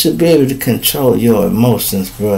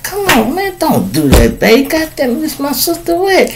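put-on pleading boyfriend; 12 semitones higher, 4 dB quieter than usual. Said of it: "You be like".

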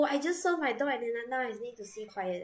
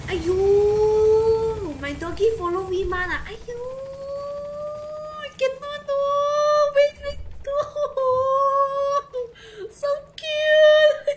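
ayyo wail; 10 dB louder than usual. Real, not acted. Squealing over cute pet dog.

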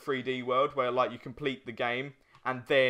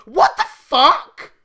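loud, drawn-out disbelieving curse; exasperated outburst; 13 semitones higher, 15 dB louder, much slower than usual. Retold or imitated. Gamer retelling his reaction, loud and disbelieving. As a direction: blurt it out, stunned and fed up. Retold own outburst while gaming.

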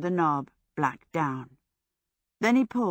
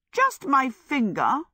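higher, slow and dignified; dignified protest, unhurried and raised in pitch; 7 semitones higher, 4 dB louder, slower than usual. A narrator's reading. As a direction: stand on your dignity, drawn out and higher. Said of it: "Sophie said with dignity".

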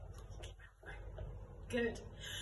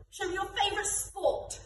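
a game caller's thrilled cue, much higher; excited game call; 9 semitones higher than usual. Shown as a demonstration. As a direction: squeal it high and bright. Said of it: "And finally".

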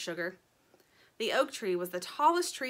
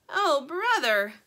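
slow, raised, louder lament; exasperated little bird; 6 semitones higher, 6 dB louder, slower than usual. A narrator's reading. Little bird repeating it until Sugar objects, slow and exasperated. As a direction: stretch it out with hammed-up dismay.

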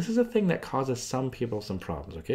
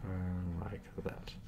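soft aside; 4 semitones lower, 12 dB quieter, much slower than usual. Real, not acted. Drawing the graph, aside.

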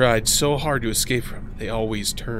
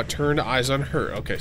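ordinary talk

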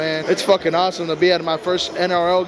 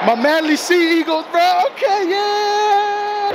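team hype; 12 semitones higher, much slower than usual. Real, not acted. Fan cheering his betting team Manly, excited. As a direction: pump up your team.

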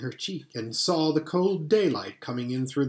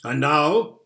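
Father Christmas; 5 dB louder than usual. Acted. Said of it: "said Father Christmas".

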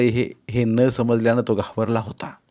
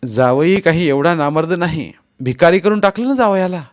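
higher, louder wounded indignation; angry fuming; 7 semitones higher, 7 dB louder than usual. A narrator's reading. Insulted son-in-law to his wife, angry fuming. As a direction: protest as if humiliated. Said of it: "रागानं धुसफुसत मी म्हणालो".

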